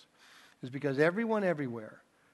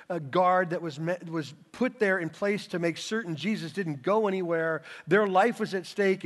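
higher, louder impassioned preaching; preaching at full force; 4 semitones higher, 5 dB louder than usual. Real, not acted. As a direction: drive the point home with fire. Preaching climax, raised voice.

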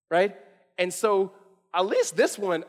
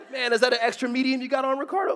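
pitched-up mock jab; playful teasing; 6 semitones higher than usual. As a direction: clown on a friend, voice climbing. Demonstrates teasing someone's shirt.

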